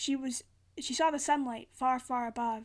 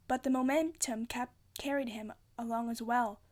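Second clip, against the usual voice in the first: plain reading voice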